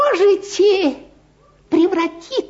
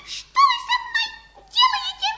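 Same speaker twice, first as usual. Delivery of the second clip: squeaky mouse voice; much faster than usual. Acted. Mouse squeals while caught.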